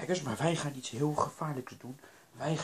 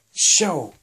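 ordinary delivery

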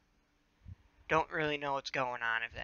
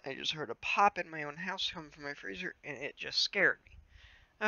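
usual speaking voice

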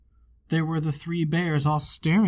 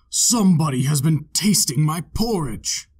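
a louder, big papa bear boom; great big bear voice; 6 dB louder than usual. A narrator's reading. Papa bear finds his porridge eaten, booming big bear voice. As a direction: make the biggest bear rumble his complaint. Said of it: "in his great big voice".

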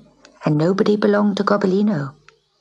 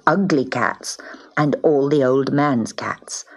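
ordinary delivery, nothing marked

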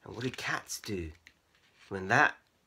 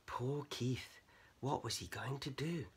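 sympathetic; 3 semitones higher, 9 dB quieter than usual. A narrator's reading. Soft sympathetic narration.

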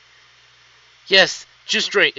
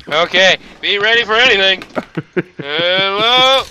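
loud calling out; 4 semitones higher, 7 dB louder than usual. Real, not acted. Bracing then calling out.

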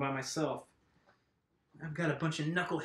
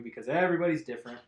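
ordinary delivery

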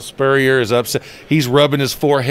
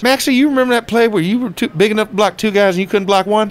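higher, ribbing coach; Spurrier imitation; 8 semitones higher than usual. Coach teasing his former player at the tailgate, high voiced. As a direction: needle him about the old play. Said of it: "And Coach Spurrier says".

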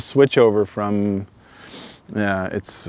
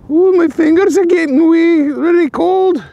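playful put-on Scottish accent, higher and louder; hammed-up Scottish accent, higher and louder; mock Scottish accent; 8 dB louder than usual. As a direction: slip into a cheeky Scottish brogue, slow on the last words. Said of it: "I hear that Scot-, Scottish people don't like it when we imitate their accent".

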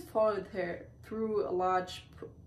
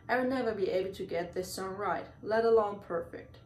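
plain reading voice

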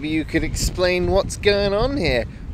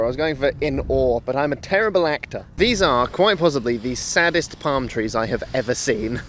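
ordinary delivery

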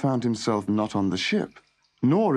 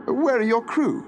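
higher-pitched, insistent questioning; calling out questions; 11 semitones higher than usual. A narrator's reading. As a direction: call the questions out across a room. Arthur calls questions to silent hostess.

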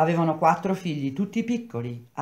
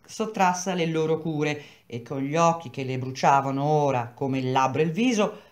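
plain reading voice

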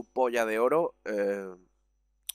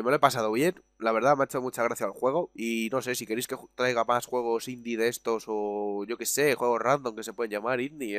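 normal talking voice

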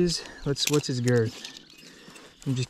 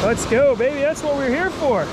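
high, loud cheer; triumphant; 12 semitones higher, 3 dB louder than usual. Real, not acted. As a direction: celebrate the catch. Landing a pike.

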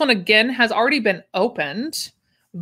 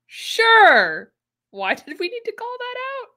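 sarcastic; 13 semitones higher, slower than usual. Real, not acted. Sarcastic aside about pattern name.

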